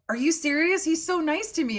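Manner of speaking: can't believe it's true; high-pitched disbelief; stunned disbelief. Retold or imitated. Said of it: "And I'm like".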